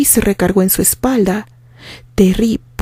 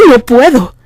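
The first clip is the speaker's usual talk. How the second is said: louder cry of refusal; anguished shout, voice breaking; 4 semitones higher, 4 dB louder than usual. Acted. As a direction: push it out as the voice cracks. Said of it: "gritó Terry con la voz quebrada".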